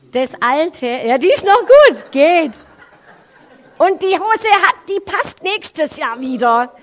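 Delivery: high, loud, hammed-up talking herself into keeping old clothes; the preacher mocks herself to the congregation, high and loud over old trousers; self-mocking excitement.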